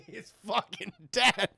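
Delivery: real laughter breaking into the words; laughing. Real, not acted. Reviewer tells chat about the grisly death, laughing. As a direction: say it through a laugh.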